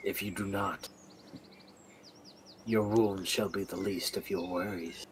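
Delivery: quiet threat. Acted. Snips final threat.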